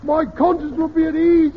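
high, loud imitation of a cry in sleep; mock sleep-talker cry. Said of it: "he says".

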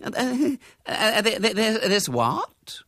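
high, stammering bewilderment; aghast stutter; gaping disbelief. A narrator's reading. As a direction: splutter in slow, high-pitched shock. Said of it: "Missus Beverton gaped at her".

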